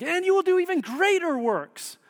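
high, excited proclamation; hyped-up promise. Acted. Preacher quoting Jesus' promise to disciples, high and hyped. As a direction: voice it as a thrilling promise.

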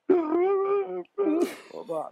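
high, strained gravelly rasp; gravelly bad guy voice. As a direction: rasp it out as if breathing in. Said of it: "he speaks in this really gravelly voice".

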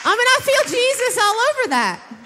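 playful high take. Pretend. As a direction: toss it up high and bright with fervor. Repeats line, second take plain.

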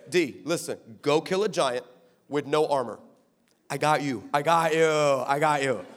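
low, softened confiding voice; low, reassuring aside; coaxing God. Acted. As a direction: talk him into it, low and easy. God coaxing David, acted.

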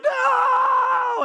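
high, loud wailing no; kid meltdown. Retold or imitated. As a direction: shout it like a toddler losing it. Kid melting down over ice cream.

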